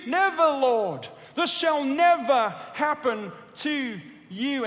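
slow, loud, high protest; slow rebuke. Acted. Peter rebuking Jesus, slow loud and high. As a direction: stretch each word as a rebuke. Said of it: "began to rebuke Jesus".

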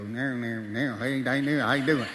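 a hint of a Southern country accent; Southern banjo accent. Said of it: "he has this accent. You know, he talks in banjo".

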